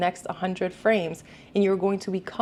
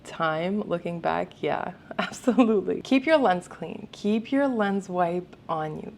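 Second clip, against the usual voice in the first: ordinary delivery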